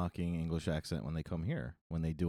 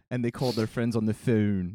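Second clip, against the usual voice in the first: put-on louder, playful Delco accent; 2 semitones higher, 6 dB louder than usual. Local continuing a friend's joke, exaggerated Delco accent. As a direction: play up the Delco O in "phone". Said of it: "if you're from Delco".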